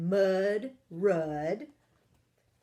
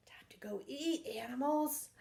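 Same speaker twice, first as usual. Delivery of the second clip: whisper; 5 semitones higher, 4 dB quieter, faster than usual. Acted.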